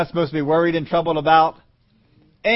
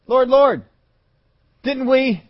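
pleading call; 9 semitones higher, 6 dB louder, slower than usual. Acted. People pleading with Jesus.

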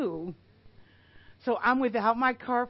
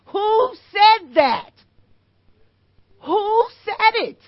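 loud, slow, higher challenge from the pulpit; preaching shout; 9 semitones higher, 10 dB louder, slower than usual. Real, not acted. Preacher challenging the congregation, loud demanding shout. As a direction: throw the question at the whole room.

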